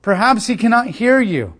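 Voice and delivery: loud, high sarcastic jeer; mocking taunt. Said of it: "He begins to taunt them".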